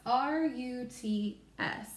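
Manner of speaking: slow letter-by-letter spelling; spelling slowly. Shown as a demonstration. Teacher spelling for young learners, slow letters. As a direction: spell it out one letter at a time. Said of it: "So we spell ruts".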